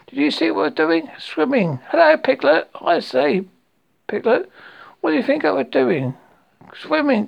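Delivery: high, eager little-animal chatter; Roo's excitement. A narrator's reading. Roo boasting to Rabbit and Piglet, high excited chatter. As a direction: pipe up high to each friend for praise.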